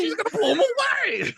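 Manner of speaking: cartoonish cracked screech; cracking shout. Host joining in, mimicking the same cracked yell. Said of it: "that way his voice goes".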